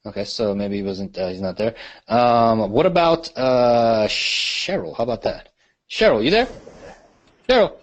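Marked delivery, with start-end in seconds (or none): 5.9-7.8 raised, higher call across the line. Real, not acted. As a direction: call her name louder, then higher. Calling out to silent caller.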